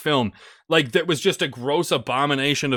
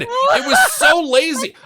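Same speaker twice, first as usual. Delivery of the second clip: exasperated; 4 dB louder than usual. Real, not acted. Ranting about movie.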